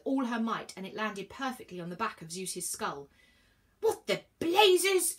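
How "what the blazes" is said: slow, loud, high blustering shout; drawn-out astonished shout. A narrator's reading. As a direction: bellow in shocked outrage, stretched out. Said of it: "shouted Zeus".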